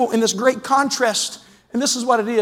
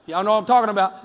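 ordinary delivery

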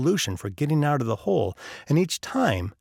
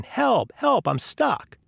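mock yell for help; 9 semitones higher, much slower than usual. A narrator's reading. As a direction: call up out of a hole, pitched high.

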